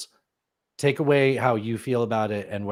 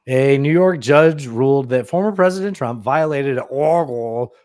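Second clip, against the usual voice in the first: loud, slow, higher headline read; put-on headline voice; 4 semitones higher, 8 dB louder than usual. Co-host attempting a voice for the New York headline, put-on. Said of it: "That's not how you sound".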